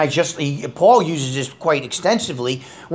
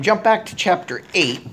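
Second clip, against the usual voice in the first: normal talking voice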